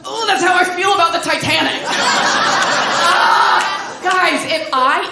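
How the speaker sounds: mock heroic boast. Boasting like Wahlberg.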